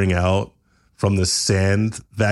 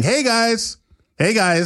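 high, bright-voiced YouTuber hello; YouTuber greeting; 13 semitones higher than usual. Sam greeting neighbors in a high YouTuber voice. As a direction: chirp it like a vlogger intro.

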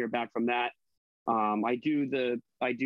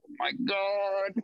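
exasperated; 5 semitones higher, much slower than usual. Retold or imitated. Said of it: "I'm like".

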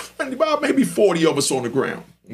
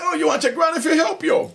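mocking guard; 10 semitones higher than usual. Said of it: "So they say".

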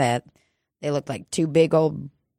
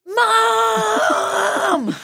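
put-on high shrill kid scream; child screaming mom. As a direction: yell for your mom like a kid. Child actor audition, screaming.

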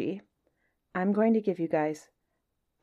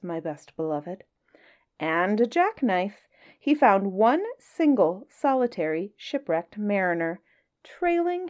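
lively storybook reading with playful lilt; story narration; 2 semitones higher, 5 dB louder than usual. A narrator's reading. Reading a Kipling story to listeners, lively storybook voice. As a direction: read it like a bedtime tale to children. Reads Just So story aloud.

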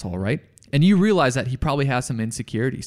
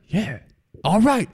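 higher-pitched, won-over Southern contractor; 6 semitones higher than usual. Acted. Contractor agreeing to teach the student, Southern accent. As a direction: put on a gruffly willing Southern accent. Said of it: "he has a southern accent".

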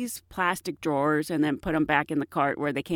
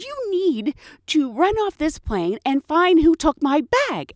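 demanding passenger; 8 semitones higher, 4 dB louder than usual. Retold or imitated. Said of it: "She goes".

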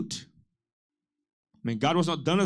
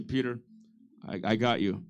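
reassuring aside; 8 semitones lower, 5 dB quieter than usual. Retold or imitated. Pastor's aside to Peter.

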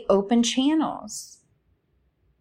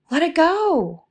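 lifted release; 9 semitones higher, 3 dB louder than usual. Shown as a demonstration. To listeners holding emotions, a high, floating release. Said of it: "So when something comes up".

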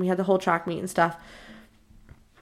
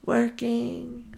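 drawn-out, lilting single word; odd boy voice; 3 semitones higher, 4 dB quieter than usual. Retold or imitated. Crush saying what he's up to, odd boy voice. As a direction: imitate his strange stretched delivery. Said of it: "Why are you talking like that?".

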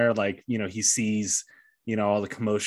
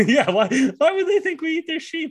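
laughing mock innocence; 5 dB louder, faster than usual.

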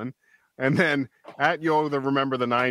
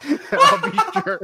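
laughing; 13 semitones higher, 5 dB louder than usual. Real, not acted. Laughing while answering.

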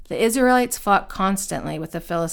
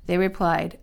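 plain reading voice

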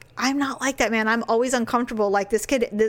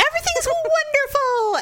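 fake cheery. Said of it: "mock fake-friendly greetings".

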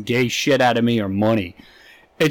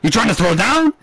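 mock gangster challenge; 7 semitones higher than usual. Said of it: "gangster quarrel".

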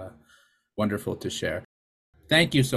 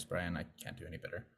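ordinary delivery

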